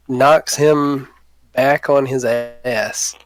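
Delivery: ordinary delivery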